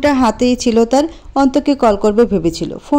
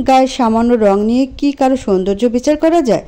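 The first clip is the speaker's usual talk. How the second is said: ordinary talk, nothing marked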